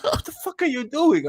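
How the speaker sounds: high laughing mock outrage; mock cousin. Cousin confronts him about the phone, high laughing. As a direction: pitch it up, half laughing. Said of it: "It's like".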